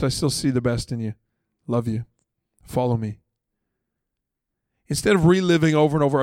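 usual speaking voice

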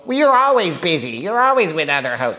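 high whiny complaint; mock the nagging girlfriend. Girl complains.

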